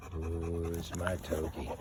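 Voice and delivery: put-on deep voice for the dog; low mock-tough voice; playful low dog-talk. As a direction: tease the dog in a low, drawn-down voice. Playful dog talk, demanding the ball.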